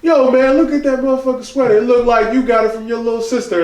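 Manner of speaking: high-pitched imitated heckler; jeering mock-amazed roast; playful roast. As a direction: open loud and high, teasing someone's look. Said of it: "somebody was like".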